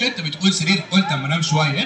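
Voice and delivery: very low-pitched puppet character; Aragoz puppet. Acted. Puppet explaining his visit to the host, Aragoz voice. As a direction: play a comic puppet, far below your own pitch. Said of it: "الأراجوز ده وهو بيرغي".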